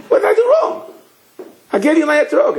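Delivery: very high-pitched, indignant appeal; acted aggrieved protest. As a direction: demand an explanation in a high wail. Visitor protests to the Rebbe.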